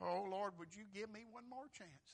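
mildly acted tearful appeal; crying plea. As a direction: plead like a soul in torment, without real sobs. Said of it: "they're crying".

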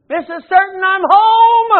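excited call. Acted. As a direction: call out joyfully like a man just healed. Husband voiced in retold story.

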